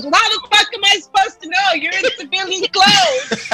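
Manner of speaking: high, quick mock outrage; indignant comeback. Drunk sailor answers a master chief, indignant comeback.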